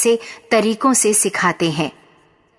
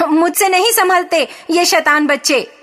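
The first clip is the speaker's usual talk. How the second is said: higher-pitched wife crying out; mild exasperated shout; 6 semitones higher than usual. A narrator's reading. Wife at her wits' end with the children, mildly shouting. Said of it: "किसान की पत्नी चिल्लाई".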